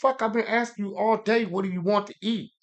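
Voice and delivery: high, heated retelling; venting about her all day; exasperated. Retold or imitated. Man venting to friend about roommate, high exasperated.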